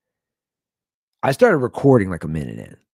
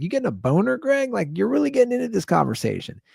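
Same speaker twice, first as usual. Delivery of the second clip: high-pitched needling voice; incredulous, high teasing questions; mock teasing; 13 semitones higher than usual. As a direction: rib a friend in a playfully raised pitch. Retells teasing his neighbor.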